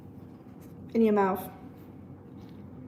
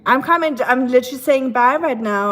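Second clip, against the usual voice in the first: calling out loud; 7 dB louder than usual. Real, not acted. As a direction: shout it back across the house. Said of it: "Are you not listening?".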